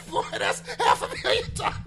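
fast, high, breathy through laughter; can barely get the words out for laughing; laughing hard. Real, not acted. Cracking up retelling the scene.

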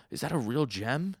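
mock astonished gasp; gasping amazement. Guys seeing the diamond Sasuke made, gasping amazement.

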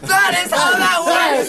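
shouting. Real, not acted. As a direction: yell it in triumph. Heated argument over a deal.

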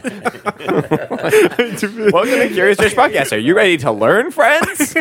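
put-on high, slow, sing-song kids' host; hammed-up children's TV presenter; children's show host. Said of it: "like, a children's show".

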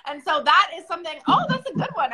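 high, delighted exclamation; suddenly thrilled, voice jumping up; excited squeal. Real, not acted. Delighted by the co-host's reminder.

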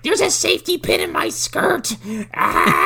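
very high, frantic panic; panicked. Retold or imitated. Wife panicking over her outfit at the gallery, very high. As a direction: shriek about the skirt. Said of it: "she's like".

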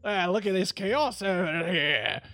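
mock Aussie space marine calling his lads; Warhammer voice. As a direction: ham up a gruff Aussie soldier. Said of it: "my Warhammer voice".

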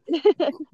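short bright laugh. Real, not acted. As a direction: burst out laughing. Amused at the interview joke.

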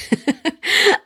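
high-pitched chuckle at oneself; amused laugh. Real, not acted. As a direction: laugh at your own slip. Laughs at own muddled metaphor.

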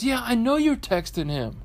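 imitated wife's retort. Wife answers husband's excuse, high imitated retort. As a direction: impersonate a wife insisting she knows.

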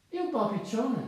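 high-pitched little squeak of a mole; small animal's high call. A narrator's reading. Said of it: "squittì".

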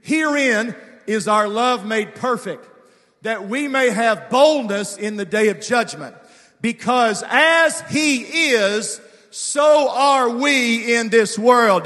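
loud slow scripture read, stretching "as he is"; slow fervent scripture reading; congregational reading. Shown as a demonstration. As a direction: lead everyone reading aloud. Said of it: "read it with me".

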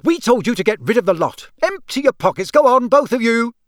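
furious. A narrator's reading. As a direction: lay into both children, voice climbing. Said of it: "said Missus Weasley furiously".

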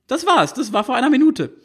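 put-on high teenage voice, matter-of-fact; a high teen girl stating the obvious. A narrator's reading.

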